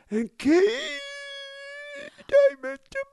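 high, strained, running out of air; breathless executive voice. Retold or imitated. A VP talking to Kate, breathless and high. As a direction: squeeze the words out on no breath. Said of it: "he loses his breath while he's speaking".

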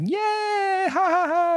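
put-on very high squeaky kid cheer; gleeful child. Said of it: "kinda like".

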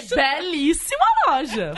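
slow, stiff Belíssima loja; slow solemn compliment; deadpan tone. Retold or imitated. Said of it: "Eu falei".

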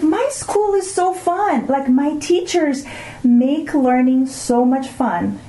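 high, slowed imitation of her son raving; excited son. Son telling mom his teachers make school fun, high slow gush. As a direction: stretch it out like an amazed teen. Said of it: "He's like".

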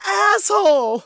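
high, loud shout of "Asshole!" with a laugh; gleeful shout. Retold or imitated. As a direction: yell "Asshole!" with glee. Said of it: "he goes".

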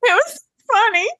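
high, squeaky laugh-cry; real laughter. Real, not acted. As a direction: break into a laugh mid-sentence. Laughing high crying sound.

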